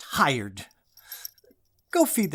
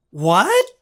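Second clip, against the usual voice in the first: startled what; 3 semitones higher, 6 dB louder than usual. Acted. Startled hearing his name.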